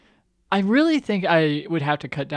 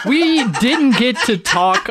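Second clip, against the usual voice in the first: mock serious; 9 semitones higher, 5 dB louder, slower than usual. Shown as a demonstration. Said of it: "I'm fucking around".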